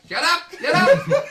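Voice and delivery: high, loud shout breaking into laughter; mock exasperated yell. Actor to the toy robot in his bag, exasperated shout. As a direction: yell at the toy while cracking up.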